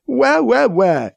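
whiny pretend sobbing; mock crying. A narrator's reading. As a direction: fake baby wail. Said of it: "Krish mock cries".

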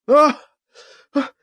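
sharp breathless gasps; gasping. Acted. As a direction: gasp for air. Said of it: "Alibi gasped".

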